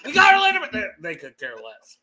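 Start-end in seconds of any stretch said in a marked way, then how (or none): 0.1-0.7 much higher, louder triumphant cry; excited shout. Retold or imitated. Father telling his daughters the signing, high and triumphant. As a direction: tell the kids the news, pitched way up. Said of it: "I'm like".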